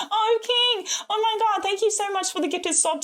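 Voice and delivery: high-pitched, delighted disbelief; overwhelmed surprise. Real, not acted. Streamer to viewer who gifted a sub, overwhelmed surprise. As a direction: squeal it at a generous friend.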